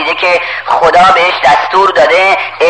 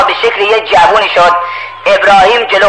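ordinary delivery

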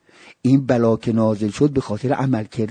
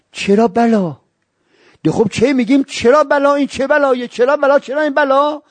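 high, loud, impassioned sermon cry; fiery preaching; 13 semitones higher, 4 dB louder than usual. Acted. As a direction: preach it to the crowd at full force. Preaching at full force.

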